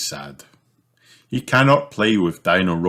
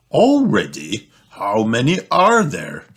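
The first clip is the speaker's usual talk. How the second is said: higher, exasperated questions; dad put out by the bother; grumbling; 4 semitones higher, 3 dB louder than usual. Acted. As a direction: complain in disbelief, pitch lifted. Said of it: "grumbles Daddy Pig".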